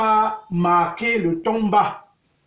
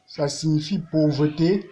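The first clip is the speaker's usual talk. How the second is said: ordinary talk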